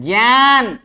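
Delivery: ज्ञान as "gyaan", gy onset, long vowel; correct ज्ञान. Shown as a demonstration. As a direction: model ज्ञान with a y glide. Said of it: "गौ भी उच्चारण नहीं करें". (ज्ञान को "ग्यान" बोलना)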